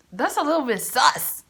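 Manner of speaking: laughing. Real, not acted. Laughing at own confession.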